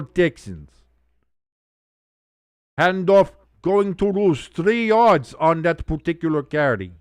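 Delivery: ordinary delivery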